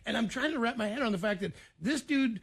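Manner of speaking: ordinary talk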